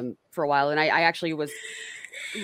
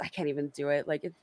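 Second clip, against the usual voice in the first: ordinary talk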